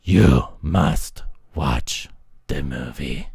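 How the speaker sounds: low, slow ominous order; slow threatening demand; hammed-up low menace; mock menacing. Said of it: "and you're like".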